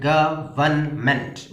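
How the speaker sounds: Government slowly split into three parts; syllable by syllable. Shown as a demonstration. Teacher counting syllables, government slowly broken in three. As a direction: separate each syllable of government. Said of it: "മൂന്ന് syllable ആണ്".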